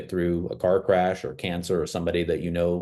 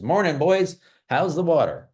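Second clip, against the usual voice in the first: put-on higher, louder friendly old-timer voice; old fish greeting; 9 semitones higher, 4 dB louder than usual. Old fish greeting the young fish, higher and louder. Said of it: "He nods to them and he says".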